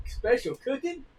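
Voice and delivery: put-on teasing kid asking about mom's cooking; boy needling dad about mom's cooking, high; mock sitcom boy. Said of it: "he goes".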